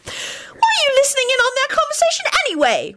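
put-on extremely high, piercing girl's voice; shrill. A narrator's reading. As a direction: squeal it out indignantly. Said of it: "one of the girls shrilled out".

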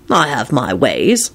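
low, slow and mysterious. A narrator's reading. Lupin hinting at his secret methods, mysterious. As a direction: drop low and draw it out secretively. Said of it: "Lupin replied mysteriously".

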